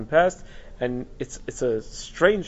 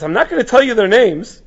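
higher and louder, falling firmly; emphatic quote; 8 semitones higher, 9 dB louder than usual. Retold or imitated. Teacher voicing the rabbi withholding names, loud and animated. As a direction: refuse to name them with gusto. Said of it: "And he says".

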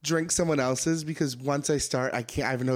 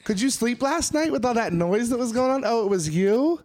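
mock chipper; 8 semitones higher, 5 dB louder than usual. Roommate greeting a hookup guest in mock-chipper voice. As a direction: fake innocent, brightly higher. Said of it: "just be all chipper".

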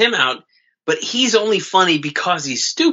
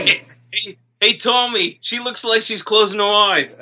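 put-on higher Boston-accented hollering; mock Boston accent; 7 semitones higher than usual. Cohost keeps calling Tommy in a Boston accent. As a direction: keep the Boston townie bit going.

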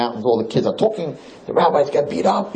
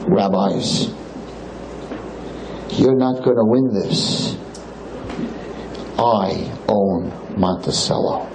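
low, slow, menacing club owner; powerful owner; 4 semitones lower, much slower than usual. Retold or imitated. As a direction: lean in low and slow like the man who owns the town. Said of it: "and he says".

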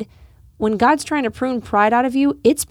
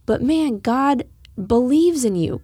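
ordinary delivery

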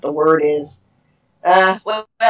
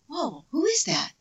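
amazed; 9 semitones higher, 8 dB quieter than usual. Acted. Acting out an amazed listener.

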